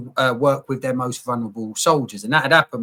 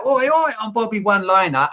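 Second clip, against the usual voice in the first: cheeky joker persona; 5 semitones higher than usual. Retold or imitated. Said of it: "your Bobby the One-Liner mask".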